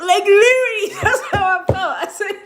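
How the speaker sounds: high, loud burst of laughter through the words; laughing hard. Real, not acted. Recalling the fight to viewers, laughing loudly. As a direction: crack up while talking.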